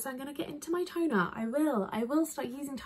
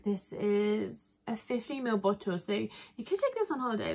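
usual speaking voice

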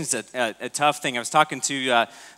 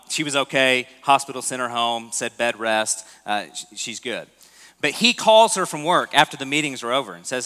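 ordinary delivery

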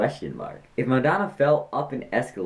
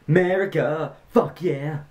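hammed-up shout of America, fuck yeah, pitched up; mock patriotic yell; 6 semitones higher than usual. Vlogger reading a joke, hammed-up crowd yell. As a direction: bellow it like a rowdy crowd cheering. Yelling chant in a told joke.

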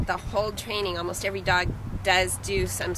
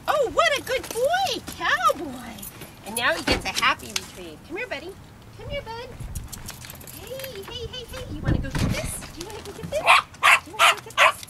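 excited dog praise; 13 semitones higher than usual. Real, not acted.

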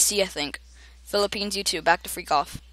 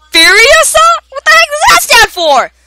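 much higher, squeaky puzzled question; 10 dB louder than usual. Pretend. Gamer reading a weird name to viewers, squeaky high voice. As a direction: squeak it out like a tiny cartoon.

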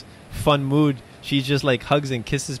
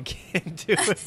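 laughing; 4 semitones higher, 6 dB quieter, slower than usual. Real, not acted. Son can't whisper.

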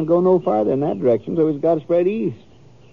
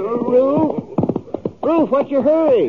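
raised, higher call across the road to a passing rider; 10 semitones higher, slower than usual. Acted. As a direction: hail him from a distance, voice up high. Calling to a rider hurrying past.

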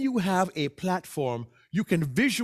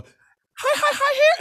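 squeaky falsetto, much higher and louder; mock high voice; 8 dB louder, slower than usual. Shown as a demonstration. Speech coach mocks an overdone high character voice. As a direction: exaggerate it way up high. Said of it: "I don't mean you have to go".

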